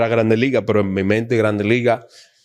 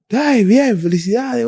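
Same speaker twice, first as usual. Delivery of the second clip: mock excited congratulations; 11 semitones higher, slower than usual. Quoting congratulations from someone.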